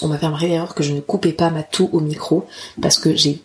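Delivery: ordinary talk, nothing marked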